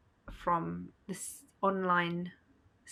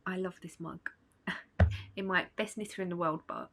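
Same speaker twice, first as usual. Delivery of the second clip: normal talking voice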